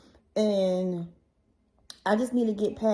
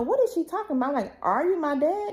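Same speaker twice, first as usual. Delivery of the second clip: high-pitched retold disbelief; distressed questioning; 7 semitones higher than usual. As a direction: act out a baffled plea for answers. Retold confronting dad about paternity.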